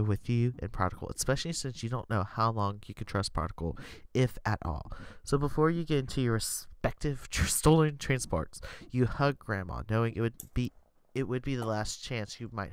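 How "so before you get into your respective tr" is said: ordinary talk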